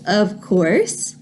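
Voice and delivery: plain reading voice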